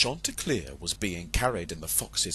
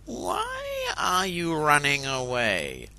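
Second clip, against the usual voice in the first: slow, sly fox voice; slow cunning fox; 6 semitones higher, slower than usual. Acted. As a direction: coax the rooster back. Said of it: "asked the fox".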